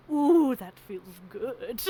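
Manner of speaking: slow, drawn-out sigh of relief; mock pampered sigh. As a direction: savor it like a spoiled patient. Mocking being pampered while sick.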